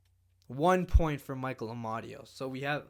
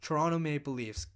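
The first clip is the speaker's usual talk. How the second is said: ordinary delivery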